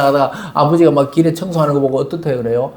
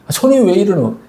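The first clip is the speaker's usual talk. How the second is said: hammed-up rude child blaming mother; mock grumbling child; 5 semitones higher than usual. Grown child teasingly blames mother for her hands, whining. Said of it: "이렇게 하고 싶겠지만은".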